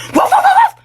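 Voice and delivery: high yapping dog barks with a laugh; barking like a dog. Pretend. Newborn baby offers to bark instead of cry. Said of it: "Gusto mo tumahol ako?".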